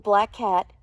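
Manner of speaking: plain reading voice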